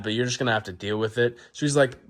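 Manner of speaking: normal talking voice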